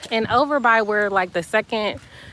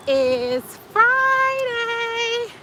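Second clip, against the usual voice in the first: show intro; 12 semitones higher, much slower than usual. Acted. Sing-song vlog show opening.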